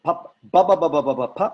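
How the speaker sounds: teacher showing the class how it's done; loud plosive demo. Shown as a demonstration. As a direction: hit each "ba" hard on the lips.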